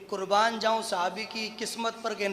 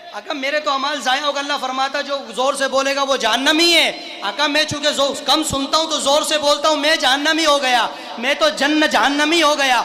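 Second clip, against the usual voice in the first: loud, raised-pitch pleading from the pulpit; full-force preaching; 5 semitones higher, 9 dB louder than usual. Retold or imitated. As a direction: build a desperate lament into a shout. Impassioned preaching, voicing the companion.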